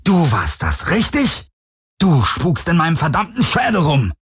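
high, furious shouting; enraged outburst at an unseen tormentor; angry roar. A narrator's reading. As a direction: yell the accusation with rising outrage. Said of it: "brüllte er die Helix an".

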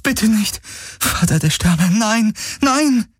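high, panicked pleading; desperate cry. A narrator's reading. Kirai begs as Hocks stops responding, desperate cry. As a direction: plead in rising panic, pitch way up. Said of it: "rief Kirai".

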